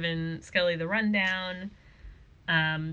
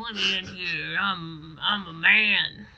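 slow, self-important bluster; indignant tough guy; slower than usual. Retold or imitated. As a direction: puff up like an offended big shot. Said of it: "He's like".

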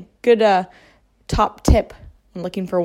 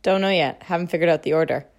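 ordinary talk

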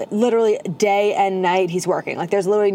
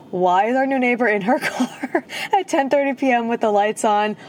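imitating a baffled neighbor through laughter; laughing mock puzzlement; 3 semitones higher than usual. Host voicing the new neighbor's thoughts, laughing. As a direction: let giggles break up the imagined question.

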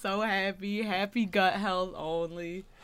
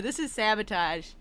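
ordinary talk

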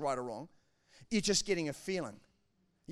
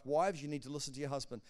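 ordinary delivery

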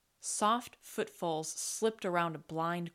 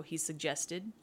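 ordinary delivery

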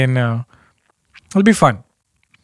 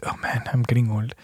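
muttered under breath, voiceless; whispered aside; 5 semitones lower, 11 dB quieter than usual. Real, not acted. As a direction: whisper it to yourself. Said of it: "Sorry for the burps".

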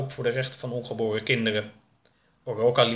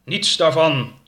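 loud, raised heroic interruption; forceful, higher-pitched command; powerful voice; 5 semitones higher, 8 dB louder, slower than usual. Acted. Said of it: "klonk plots een krachtige, bekende stem".

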